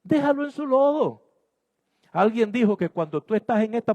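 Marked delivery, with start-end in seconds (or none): 0.0-1.2 high, sing-song mock scolding. Someone mocking a listener who fought the pig, high mock scold. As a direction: tease in a higher put-on voice. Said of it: "Usted va a salir y dice".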